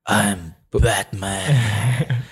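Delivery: low, slow gravelly hero line; Batman voice. Acted. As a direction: growl it like a caped hero. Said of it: "Dark Knight".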